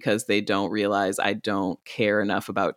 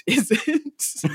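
laughing; 9 semitones higher than usual. Real, not acted. Laughs at her own punchline.

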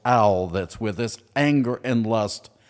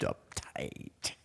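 sudden breathy whisper, one word dropped low; 12 dB quieter than usual. Acted. Host listing angry words, whispers this one.

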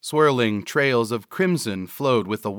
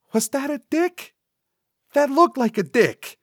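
sidekick's squeaky-high accusation; high-pitched disbelief; 3 dB louder than usual. Acted. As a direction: demand answers in a very high voice. Cecil accuses Vernal.

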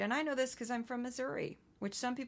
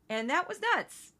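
usual speaking voice

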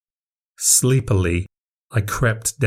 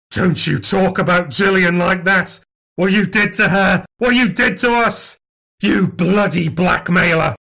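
much higher, loud, protective fury; furious defence; 8 semitones higher, 3 dB louder than usual. Acted. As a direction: stand up for her, venom rising.

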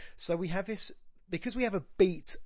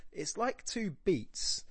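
normal talking voice

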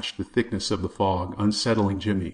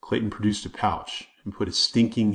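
plain reading voice

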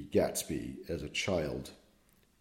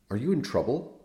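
higher, sharp, urgent priest; sharp question; 7 semitones higher, 6 dB louder, faster than usual. Acted. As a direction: snap the question at the boy. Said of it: "Schwartz sharply".

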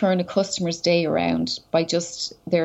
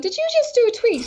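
much higher, delighted mock surprise; surprised barman; 14 semitones higher than usual. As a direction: play the barman catching on, amazed. Pub guy spots her tweet.

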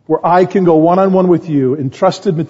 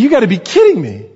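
retold disbelief, pitched up; incredulous; 6 semitones higher than usual. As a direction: react in mild mock astonishment. Retells his disbelief at mom's news.

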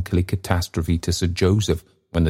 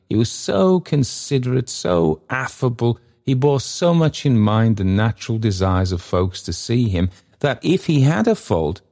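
higher, gushing storyteller; effusive mock-admiring narration; 4 semitones higher than usual. A narrator's reading. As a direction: swoop up on the praise, then settle.

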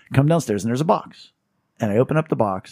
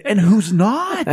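high, slow sly retort; slow teasing comeback; 9 semitones higher, 4 dB louder, much slower than usual. Retold or imitated. Answering Tommy's afterlife joke, high slow and sly. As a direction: drag it out slyly. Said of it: "And I said".

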